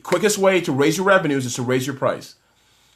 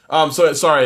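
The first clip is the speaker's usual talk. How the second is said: ordinary delivery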